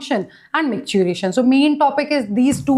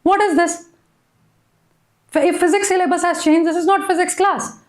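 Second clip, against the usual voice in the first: exasperated scolding; 6 semitones higher than usual. Real, not acted. Teacher scolding spamming student.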